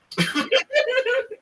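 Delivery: a high, squealing burst of laughter. Real, not acted. Host reacting to his own office story, high laugh. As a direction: crack up at the punchline.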